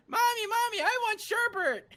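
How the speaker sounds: put-on very high little-kid voice; whining child. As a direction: squeal it like a demanding small child. Voices child at ice creamery.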